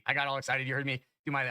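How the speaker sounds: usual speaking voice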